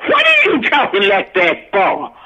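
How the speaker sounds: laughing through a retold question; giggling mock question about the accent; laughing quote. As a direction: quote him while cracking up. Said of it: "Bill comes and said".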